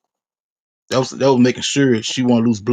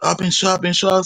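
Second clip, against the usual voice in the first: much higher frightened retold cry; retold panicked cry; 8 semitones higher than usual. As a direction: echo her cry of being shot, voice up high. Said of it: "And she was like".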